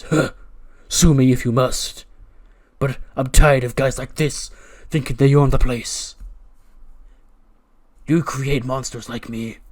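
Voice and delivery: loud, dark villain declaration; proud and menacing, like a cult leader; defiant villain. Acted. Karrion Kross defying security as he leaves, dark and loud. Cross dragged off, defiant speech.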